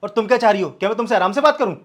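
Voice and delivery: husband angrily throws her tone back at her; heated retort. Acted.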